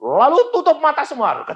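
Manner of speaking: loud, high, stern command; angry pastor. Retold or imitated. Angry pastor ordering everyone to pray, loud command. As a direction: bark it at the congregation. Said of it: "pendeta marah".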